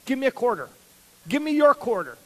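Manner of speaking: put-on boy demanding his sister's quarter; demanding kid. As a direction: insist like a bratty kid.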